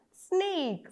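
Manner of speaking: ordinary delivery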